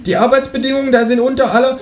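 very high kangaroo shout-out; kangaroo calling after. Acted. Kangaroo warns the departing shopper, calling very high. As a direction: call it after them, pitched right up. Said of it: "ruft mir das Känguru hinterher".

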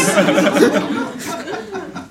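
high cackling kangaroo laugh. Acted. (hohes Känguru-Lachen)

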